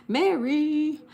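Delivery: loud, high, drawn-out greeting; high, loud holler hello; calling out. Real, not acted. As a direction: sing out the name, stretched long. Calls out greeting to viewer Mary.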